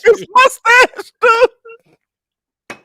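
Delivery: drawn-out squeaky mock voice; Steve Harvey mustache joke. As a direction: stretch it out high like a goofy impression. Mocking Steve Harvey impression.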